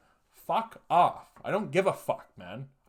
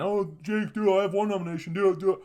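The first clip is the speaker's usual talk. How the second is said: high, mocking nag voice; mock nagging taggers; 6 semitones higher, 4 dB louder, faster than usual. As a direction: parody the pestering friends, pitched up. Mocking people who tag him.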